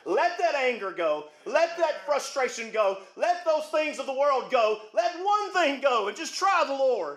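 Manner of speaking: slow, deliberate pleading; slow exhortation. Acted. Pastor pleading with the church to let go, slowly. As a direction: lay each line down slowly.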